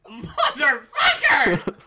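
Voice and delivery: drawn-out high shout through a laugh; loud outburst. Real, not acted. Game master reacting to the roll, loud laughing shout. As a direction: yell it long, loud and high.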